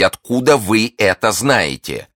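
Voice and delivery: slow, high, emphatic question; insistent demand. A narrator's reading. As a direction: demand it word by word. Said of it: "повторила миледи". (настойчиво, с расстановкой)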